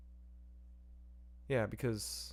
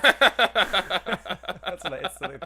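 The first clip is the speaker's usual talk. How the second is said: laughing; 6 semitones higher, 5 dB louder, much slower than usual. Real, not acted. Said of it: "laughing at own joke".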